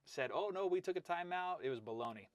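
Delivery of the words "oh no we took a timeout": higher-pitched mimicked excuse; mock protest. Wrestling coach mimicking others' excuse about a timeout, mock protest. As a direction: imitate a feeble excuse in a raised voice.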